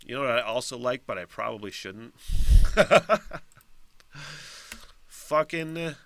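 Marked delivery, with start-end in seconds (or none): 2.2-3.4 short high, loud laugh. Real, not acted. Admitting what they shouldn't like, a loud laugh.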